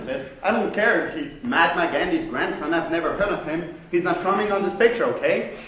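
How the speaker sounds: ordinary talk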